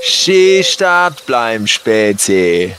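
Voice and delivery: slow threatening Bavarian drawl; menacing Bavarian thug. Acted. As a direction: menace them slowly in dialect. Robber stops campers in the woods.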